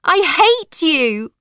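loud, high angry outburst; shouting. A narrator's reading. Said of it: "I shouted".

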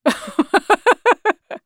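high, staccato acted cackle; officer's jeering laughter; mocking laugh. A narrator's reading. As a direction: laugh scornfully in someone's face.

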